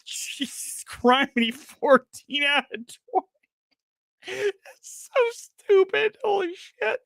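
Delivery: high, slow, crying with laughter; laughing to tears. Real, not acted. Host crying with laughter at the review score, tearful laughter. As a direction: squeak out the words through tears. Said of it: "I'm literally crying".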